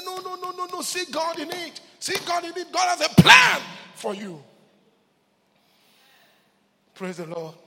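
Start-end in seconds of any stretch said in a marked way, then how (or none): 0.0-4.4 high, loud urgent sermon; preacher urging the church to see God; preaching at full force. Acted. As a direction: rebuke the despair, rising. Preacher rebukes despair loudly.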